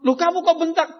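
high, loud indignant rebuke; scolding shout. Acted. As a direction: snap back at the child. Parent scolding child for snapping.

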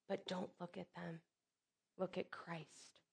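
very soft, slowing to a close; hushed sincerity. Real, not acted. Preacher turning listeners from circumstances to Christ, hushed sincerity. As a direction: drop right down into quiet, earnest counsel.

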